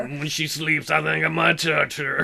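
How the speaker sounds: put-on slow slurred drunk guy voice; lightly voiced creepy drunk. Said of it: "Do your drunk guy voice".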